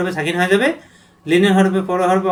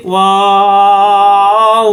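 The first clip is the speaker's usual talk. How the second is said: Wow held very long and louder, lips rounded; drawn-out letter name; 6 dB louder, much slower than usual. Shown as a demonstration. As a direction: stretch wow out slowly and loud. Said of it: "দুই ঠোঁট মেলবে না".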